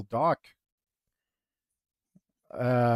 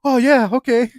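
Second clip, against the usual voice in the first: higher, louder eager yes; eager guy; 9 semitones higher, 9 dB louder than usual. Retold or imitated. The dude happily accepts the girl's invite, eager and loud. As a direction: jump at the invite, delighted.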